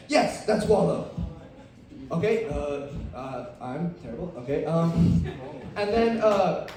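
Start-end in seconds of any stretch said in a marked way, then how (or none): none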